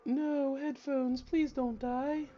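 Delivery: high, slow pleading whine; mock plea. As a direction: beg the headphones dramatically. Pleading with dying headphones.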